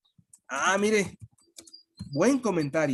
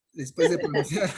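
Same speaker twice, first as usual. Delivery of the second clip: laughing; 4 semitones higher than usual. Real, not acted. Joke about spitting on screen.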